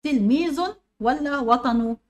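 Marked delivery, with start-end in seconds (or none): 0.0-0.6 "تلميذ" with a sounded final n; tanween demo. Shown as a demonstration. Teacher showing students the unwritten noon, "تلميذ" ending in -un. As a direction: say "تلميذ" higher and louder, ending in -un. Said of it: "والتنوين دي عبارة عن نون بتنطق".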